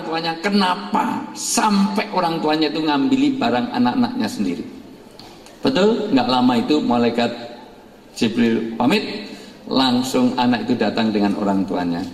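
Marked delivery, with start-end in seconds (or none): none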